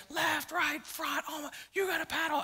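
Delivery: high, strained yell without full volume; mock screaming. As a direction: imitate a guide shrieking orders. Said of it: "He's screaming at us".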